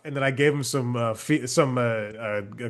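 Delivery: usual speaking voice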